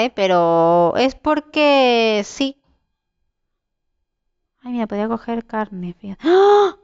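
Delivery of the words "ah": sudden high, loud yelp; high startled "ah"; startled scream. Real, not acted. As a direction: cry out in shock. Startled by an enemy in game.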